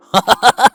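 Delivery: loud burst of laughter; big amused laugh; teasing laugh. Acted. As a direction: cackle at your own joke. Teasing Caleb as a boomer.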